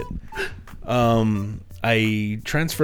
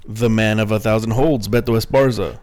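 announcer intro; 6 dB louder than usual. Acted. Hyping up cohost intro.

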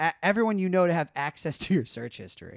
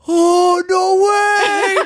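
much higher and louder, near tears; overjoyed; 14 dB louder, much slower than usual. Retold or imitated. Friend hearing her pregnancy news, overjoyed crying. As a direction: burst out in happy disbelief. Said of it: "He goes".

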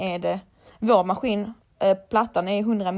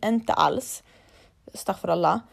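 ordinary delivery

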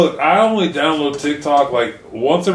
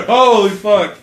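shocked; 8 semitones higher, 5 dB louder, slower than usual. Real, not acted. Shocked at himself.

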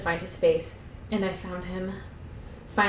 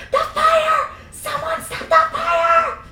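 very high, drawn-out hammed-up shriek; scream; 5 dB louder, slower than usual. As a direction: scream for help as if burning. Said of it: "I screamed as it burned me".

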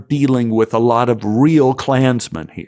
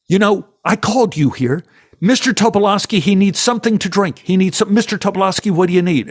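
higher, fast officious voice; bossy assistant director; 8 semitones higher, faster than usual. Retold or imitated. Assistant director ordering the boy around, officious and fast. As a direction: play the pushy set boss. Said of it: "he goes".

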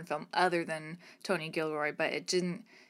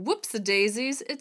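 higher, louder sing-song setup; silly quote; 4 semitones higher, 4 dB louder than usual. Retold or imitated. Host giving an example of the game, sing-song. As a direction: chirp it playfully. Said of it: "for example".

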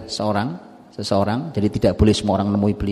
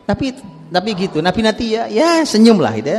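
excited telling; 11 semitones higher, 4 dB louder than usual. A narrator's reading. Preacher excited about Umar's joke.

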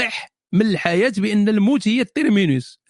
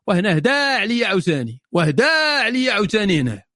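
normal talking voice

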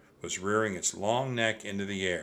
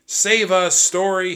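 high, loud desperate plea; crying out; 9 semitones higher, 11 dB louder than usual. A narrator's reading. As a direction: cry out to the book for rescue. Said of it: "he cried".

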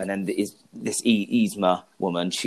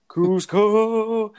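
much higher belted name; excited shout; 11 semitones higher, 4 dB louder than usual. Retold or imitated. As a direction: belt it like the theme song. Singing along to opening credits.

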